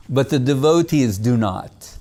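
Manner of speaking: ordinary talk, nothing marked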